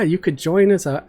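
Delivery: ordinary delivery, nothing marked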